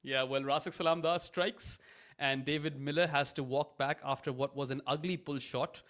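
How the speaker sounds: ordinary talk, nothing marked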